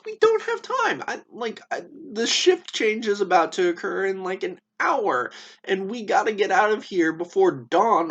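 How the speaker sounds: hammed-up alarm, begging him to run; frantic friend. Crito urges jailed Socrates to escape before dawn, frantic high pleading.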